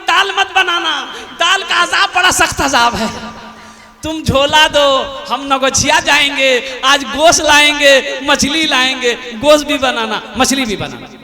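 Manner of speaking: loud eager husband calling orders; husband's loud order. Acted. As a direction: insist loudly, now he wants meat. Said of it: "तो बीवी से बोला". (ज़ोरदार उत्साहित पति)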